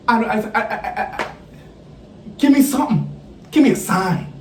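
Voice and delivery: stumble, then burst out begging; desperate prayer. Retold or imitated.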